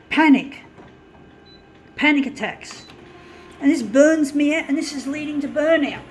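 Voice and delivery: loud, rising fury, swearing at the TV; angry rant. Real, not acted. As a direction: vent it all, building to a shout. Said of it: "make these anger, angry rants".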